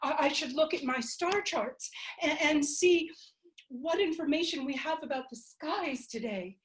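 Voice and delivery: eager planning. Acted. Selena calling Tuli to plan the next election, excited. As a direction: rush to start the next fight.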